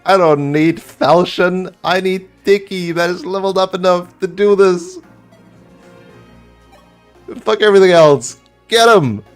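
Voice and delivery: loud, high, thrilled yelling; excited shouting. Real, not acted. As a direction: cheer the winning crit at full voice. Big crit wins the final battle.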